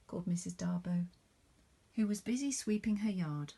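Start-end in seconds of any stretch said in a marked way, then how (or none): none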